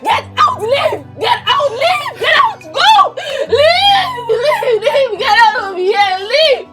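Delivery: high, loud sobbing screams; grieving wife screaming at husband to leave; hysterical screaming. Acted. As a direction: drive him out screaming.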